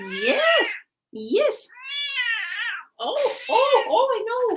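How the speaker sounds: high, bright mock cat-talk squeals; excited squeal. Streamer answering her chatty cat with excited squeals. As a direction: squeak back at the cat like a pet.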